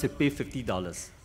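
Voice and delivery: ordinary talk, nothing marked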